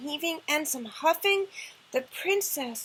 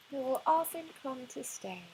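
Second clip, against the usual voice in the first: ordinary delivery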